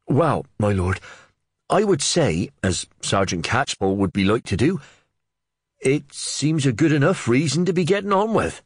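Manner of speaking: a cheeky lad with a quick answer; Wakelin cheeky. Acted.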